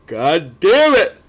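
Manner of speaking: loud, drawn-out curse of frustration; exasperated cursing. Real, not acted. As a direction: break off and swear at yourself, slowly. Curses another yawn mid-answer.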